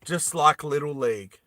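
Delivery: usual speaking voice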